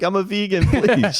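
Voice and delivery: hammed-up prim high voice; prissy mock voice. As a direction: say it like a fussy vegan. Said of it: "She's like".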